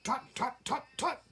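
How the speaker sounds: very high, quick tutting chatter; chattering squirrel. A narrator's reading. Squirrel chattering over whether the fawn is hungry. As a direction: tut like a busy squirrel. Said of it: "chattered the squirrel".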